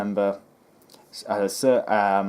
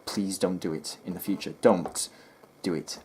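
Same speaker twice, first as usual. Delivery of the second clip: normal talking voice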